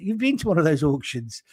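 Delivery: ordinary delivery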